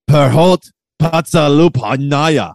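slow, loud hammed-up Russian accent; bad Russian accent. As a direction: lay on a clumsy Russian accent. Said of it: "that's a pretty bad Russian accent".

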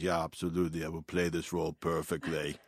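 low, quiet Austrian action-star voice; Schwarzenegger impression. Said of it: "and then he goes, he goes".

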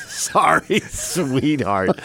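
mock condescending echo through a laugh. Host echoing his co-host's put-down, mock condescending. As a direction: parrot it back, amused and patronizing. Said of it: "So condescending".

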